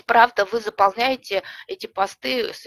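normal talking voice

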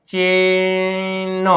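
ચેન with a very long એ; slow sounding-out of ચેન; drawn-out pronunciation demo. Shown as a demonstration. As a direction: stretch ચેન slowly, landing on ન. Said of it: "C H એટલે ચ".

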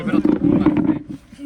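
shouting. Real, not acted. Excited shout over phone.

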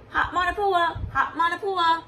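sing-song street vendor's call; hawking buns down the lane. Acted. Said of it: "calling out".

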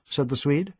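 plain reading voice

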